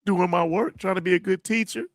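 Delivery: high, tearful voice; close to crying. Real, not acted. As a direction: speak while holding back real tears. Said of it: "I'm gonna cry again".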